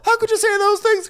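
very high, singsong mock wail; hammed-up high-pitched hysterics; imitating Kyle. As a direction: squeal it like a musical refrain. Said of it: "musical moment where Kyle goes".

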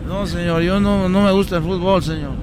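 drowsy man. Acted. Passenger replies in other voice.

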